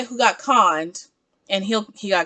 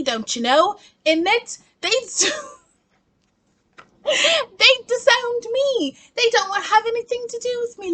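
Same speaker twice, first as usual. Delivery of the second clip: put-on over-the-top Irish accent, much higher; campy Irish accent; 8 semitones higher than usual. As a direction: ham up a fake Irish heiress accent. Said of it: "you have to subscribe for that accent".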